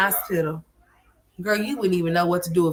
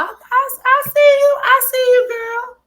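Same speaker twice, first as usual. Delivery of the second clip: very high, loud squeal; loud falsetto teasing; teasing call-out; 10 dB louder, slower than usual. Acted.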